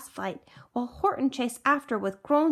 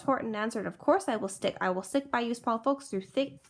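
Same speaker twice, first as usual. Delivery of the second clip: plain reading voice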